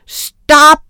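high, very loud yell; piercing howl of an order; howled command. A narrator's reading. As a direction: yell over a rowdy room to halt it. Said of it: "hylede Carla".

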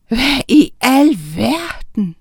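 slow, drawn-out amazed outburst; what-on-earth exclamation; astonished exclamation. A narrator's reading. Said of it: "udbrød han".

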